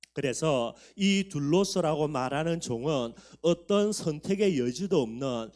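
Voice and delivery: ordinary talk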